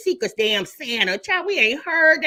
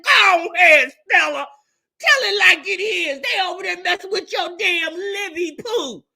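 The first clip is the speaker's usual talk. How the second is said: louder, higher hype-woman yell; cheering Stella on; 6 semitones higher, 5 dB louder than usual. Retold or imitated. Host cheering on Stella as she takes the phone, loud hype yell. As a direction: egg her on like from the bleachers. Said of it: "I said".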